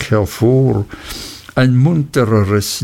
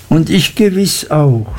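put-on higher voice for a young wife; blushing, happy agreement; 5 semitones higher than usual. A narrator's reading. Sophie joins the two happy friends, blushing agreement. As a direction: chime in lightly, pitched up. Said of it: "stimmte Sophie errötend und lachend ein".

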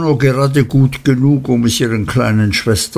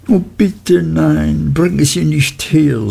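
put-on higher, slow childish begging; girlish Dora, pleading; 6 semitones higher than usual. A narrator's reading. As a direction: beg like a frightened child. (höher, langsam und flehend)

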